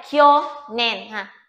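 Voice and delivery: "Kyo nen" stretched out slowly, syllable by syllable; slow demo. Shown as a demonstration. Teacher slowing down for students, slow demo reading. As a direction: draw out "Kyo nen" so students can follow. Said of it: "Đây, kyo đây".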